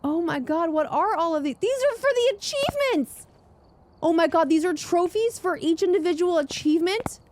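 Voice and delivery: high-pitched thrilled outburst; amazed excitement. Real, not acted. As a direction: squeal in real amazement at a discovery. Discovers trophies for each achievement.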